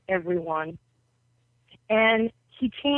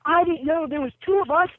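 mock surprise; 8 semitones higher than usual. Acting out Ali's amused surprise.

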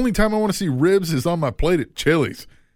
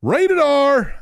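loud catchphrase; 12 semitones higher, 7 dB louder than usual. Acted. Shouted catchphrase.

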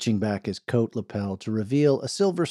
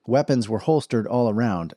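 usual speaking voice

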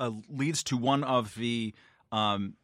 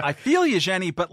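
louder and higher, teasing sympathy; mock sympathy; 7 semitones higher, 6 dB louder than usual. Answering Jenny's panicked tweet, teasing louder sympathy. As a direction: tease her kindly before the but.

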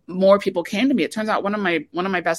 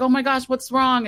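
concerned friends; 8 semitones higher than usual. Retold or imitated. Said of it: "We're like".

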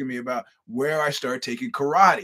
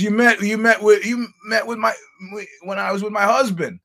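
loud, higher, eager retelling of her words; excited recognition; 8 semitones higher, 8 dB louder than usual. Retold or imitated. Woman excitedly recounting meeting.

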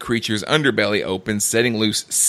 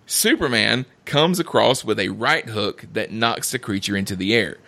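normal talking voice

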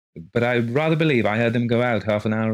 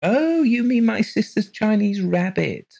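high, slow, sudden realization; as if it just dawned on her; suddenly enlightened; 6 dB louder than usual. Acted. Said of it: "as if suddenly enlightened".

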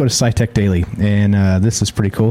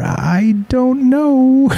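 high drawn-out sing-song; much slower than usual. Acted. Sing-song shrug about the future.

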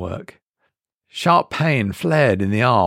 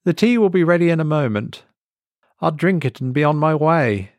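higher, fast waitress voice; 7 semitones higher, faster than usual. Acted. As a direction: rattle it off in her voice.

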